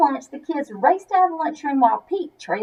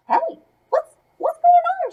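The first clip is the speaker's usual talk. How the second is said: shouted; 14 semitones higher than usual. A narrator's reading. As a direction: call out in alarm across the field. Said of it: "shouted David".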